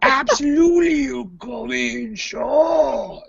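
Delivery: high, drawn-out put-on voice; mock hearty welcome. Host acts out admitting a late guest, exaggerated high welcome. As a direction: welcome them in a silly, slow high voice.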